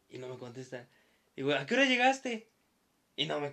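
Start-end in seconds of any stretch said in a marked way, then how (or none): 1.5-2.5 higher-pitched mock call to a friend; retold call-out. Narrator asks unseen friend when he arrived, retold calling out. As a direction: call a question through to another room. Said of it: "le grito a mi amigo".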